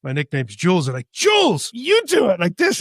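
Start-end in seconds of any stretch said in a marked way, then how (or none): 1.1-2.8 high, singsong teasing push; much higher mock-coaxing tease; teasing brothers. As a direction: egg him on like a cheeky brother. Brothers teasing him to read.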